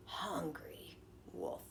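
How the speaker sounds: softly whispered, slow; ominous whisper. A narrator's reading. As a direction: hush it like a secret threat. Whispered menace in narration.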